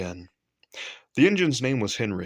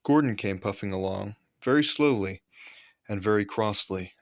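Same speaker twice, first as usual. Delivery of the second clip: plain reading voice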